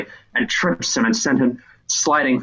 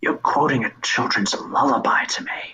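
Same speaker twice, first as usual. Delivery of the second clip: hushed whisper, incredulous; whispered figure; 2 semitones higher than usual. Acted. Figure whispering incredulous reply to the boy. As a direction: whisper it in disbelief.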